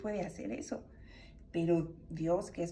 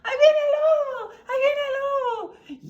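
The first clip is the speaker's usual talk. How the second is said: very high, loud wailing holler; prankster's shrieked warning; false alarm cry; 13 dB louder than usual. Acted. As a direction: cry wolf at full voice, words stretched out. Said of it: "Y otra vez decía".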